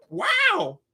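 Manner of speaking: a cool, unbothered wow; mock unimpressed wow. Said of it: "he's like".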